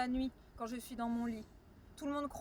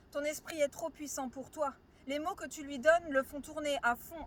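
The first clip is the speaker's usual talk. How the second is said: ordinary talk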